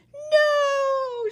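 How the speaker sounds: loud high cry; shouting. Acted. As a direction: stop him with a yell. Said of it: "shouted Bartholomew".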